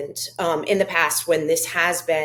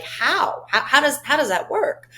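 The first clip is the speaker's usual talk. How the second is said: mock incredulous scream; 8 semitones higher than usual. Host reenacting her reaction to a televised speech, mock incredulous scream. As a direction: act out a disbelieving cry at the TV, held back. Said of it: "I'm screaming at the television, like".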